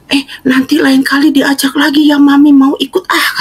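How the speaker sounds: imitating an eager elderly mother. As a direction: play mom keen to come back. Mother asks to come again.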